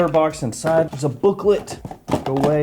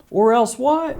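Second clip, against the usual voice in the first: high incredulous; 11 semitones higher than usual. Acted. Mock challenge.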